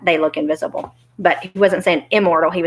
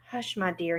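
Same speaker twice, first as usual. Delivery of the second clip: hushing; 11 dB quieter than usual. Acted. Little man fears being overheard, hushing softly. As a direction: drop the volume to shush her.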